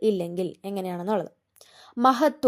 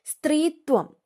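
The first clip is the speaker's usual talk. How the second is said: സ്ത്രീത്വം pitched higher, falling at the end; announced word; 5 semitones higher, slower than usual. Shown as a demonstration. Teacher announcing the word to students, pitched up. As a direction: call out സ്ത്രീത്വം on a raised note. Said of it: "സ്ത്രീത്വം ആണ്".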